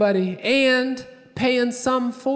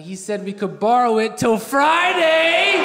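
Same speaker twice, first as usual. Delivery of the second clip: comically thrilled good news; gleeful announcement; 2 semitones higher than usual. Acted. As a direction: pitch climbing steadily to an excited peak. Gleeful punchline reporting son's offer.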